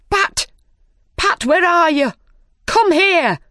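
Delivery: high, loud rabbit shout; frantic rabbit shouting for his servant. A narrator's reading. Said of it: "shouted the rabbit".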